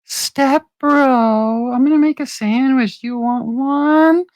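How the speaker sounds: a whiny sitcom stepbrother offering food; stepbrother impression. Retelling a comedy sketch as the stepbrother. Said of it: "because it's just".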